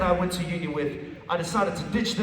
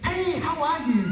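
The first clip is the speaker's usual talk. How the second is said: higher-pitched mock friendly hello; cheery greeting; 7 semitones higher than usual. Someone greeting a man who hates people, mock cheery. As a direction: imitate a chirpy, friendly greeting.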